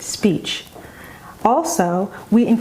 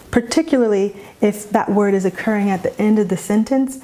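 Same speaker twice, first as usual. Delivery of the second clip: usual speaking voice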